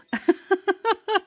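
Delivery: high-pitched giggling laugh; real laugh. Real, not acted. Reader overcome by a card reading, high laughter. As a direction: let a high laugh spill out.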